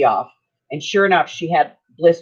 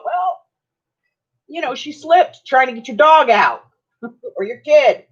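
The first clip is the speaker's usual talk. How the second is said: lightly voiced judge, higher, half-laughing; mock judge; 9 semitones higher, 3 dB louder than usual. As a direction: play a judge shrugging it off, with a laugh. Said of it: "most judges would go".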